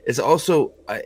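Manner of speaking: ordinary talk, nothing marked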